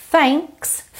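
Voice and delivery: "Thanks" with a blown voiceless th; airy TH. Shown as a demonstration. Teacher demonstrates for learners, "Thanks" with airy th. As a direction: push air through the th of "Thanks". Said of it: "com arzinho".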